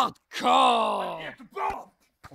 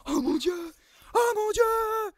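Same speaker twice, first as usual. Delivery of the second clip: building from excited to a high, slow cry; rising disbelief, then a stretched wail; 11 semitones higher, slower than usual. Real, not acted. Commentator escalating disbelief.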